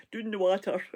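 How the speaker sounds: "Doon" with a long oo, laughing lightly; a laughing Scots "doon the water"; Scottish phrase. Shown as a demonstration. Laughing at herself, saying the Scots phrase "doon the water". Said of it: "Terrible, terrible accents".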